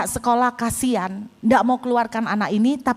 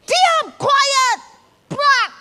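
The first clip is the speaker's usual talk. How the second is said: high, furious teacher yell; high, punchy mock outburst; re-enacted angry shout; 13 semitones higher, 4 dB louder, much slower than usual. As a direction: silence a rowdy class, acting it out. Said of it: "Saya marah, saya emosi".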